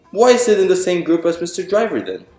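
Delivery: usual speaking voice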